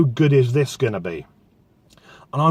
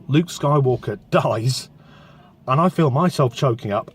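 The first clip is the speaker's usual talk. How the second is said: ordinary talk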